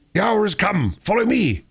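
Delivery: high, ringing call to charge; rallying cry. Acted. Badger leading his comrades into the hall, crying out. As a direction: rouse your comrades to attack.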